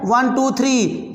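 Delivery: slow counting. Shown as a demonstration. Counting decimal jumps for students.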